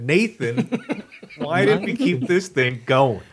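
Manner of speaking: high, laughing question. Real, not acted. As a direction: ask it through a laugh. Laughing while asking.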